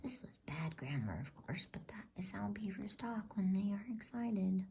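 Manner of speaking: plain reading voice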